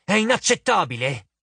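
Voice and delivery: high, protesting exclamation; indignant cry. A narrator's reading. Arachne rejecting Annabeth's idea, crying out. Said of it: "gridò Aracne".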